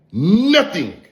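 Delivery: Nothing jumped high and hit hard; Nothing thrown up high in scorn; stress on Nothing. Real, not acted. Repeated insult, pitched up.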